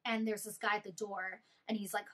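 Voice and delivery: ordinary talk, nothing marked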